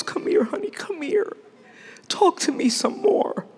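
tender whisper. Pretend. The Lord coaxing a praying believer, tender whisper. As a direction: whisper like calling a child close. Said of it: "the Lord goes".

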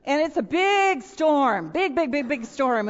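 ordinary talk

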